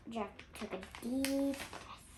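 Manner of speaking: plain reading voice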